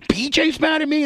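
B J's mad at me? in a very high, falsetto innocent squeak; mock innocent squeak. Host feigning innocence to the angry coworker, high squeaky voice. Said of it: "I just go".

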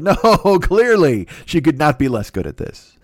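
ordinary delivery

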